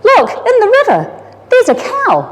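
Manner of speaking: put-on a little boy calling his parents to the window; excited boy shout. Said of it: "shouted to his mum and dad".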